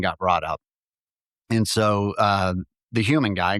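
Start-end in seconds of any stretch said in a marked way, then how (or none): none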